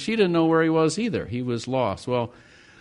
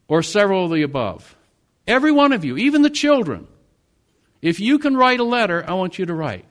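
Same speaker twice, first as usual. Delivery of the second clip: high, loud preaching call; rally the whole church; rousing exhortation; 6 semitones higher, 5 dB louder than usual. Real, not acted. Pastor urging the congregation to write, loud and high.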